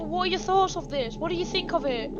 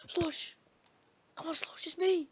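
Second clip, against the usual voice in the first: very soft, hushed plea; gentle coaxing; 12 dB quieter than usual. Pretend. Coaxing possessed Slush back to himself, gentle coaxing. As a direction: coax them back gently.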